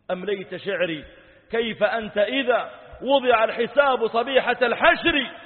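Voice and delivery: high, ringing cry of warning; preacher's voice surging high and loud; loud impassioned verse. Acted. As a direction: belt out the line as a warning. Verse climax on Judgement Day.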